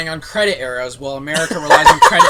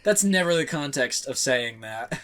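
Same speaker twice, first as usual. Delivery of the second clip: ordinary talk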